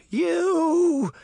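very high, loud cry of horror; horrified cry. A narrator's reading. Deputy facing the Headless Horseman, crying in horror. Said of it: "the deputy cried in horror".

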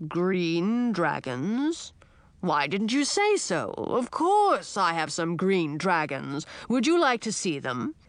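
put-on slow, rumbling old dragon; a grand old dragon, taking his time; old dragon king.

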